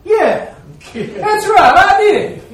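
high, loud shout with a laugh; elated shout. Retold or imitated. Preacher retells agreeing he ground bigger stumps, elated shout. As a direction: crow it triumphantly through laughter. Elated answer to encouragement, retold.

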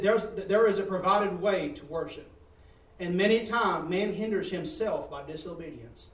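loud, emphatic preaching. Acted. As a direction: drive the point home to the congregation. Preaching the key point.

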